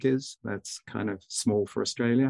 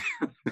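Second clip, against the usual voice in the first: laugh; 5 dB quieter than usual. Real, not acted. Husband laughs at own joke.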